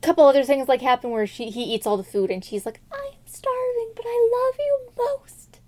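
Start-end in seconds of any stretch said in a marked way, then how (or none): 2.9-5.7 mock weepy whimper. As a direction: whimper it pitifully. Starving princess whimpers.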